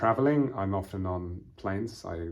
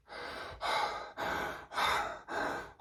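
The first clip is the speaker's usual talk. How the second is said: breathless huffing and puffing; heavy panting; 5 dB quieter than usual. Shown as a demonstration. Teacher showing learners out of breath, heavy panting. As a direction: pant hard as if just run.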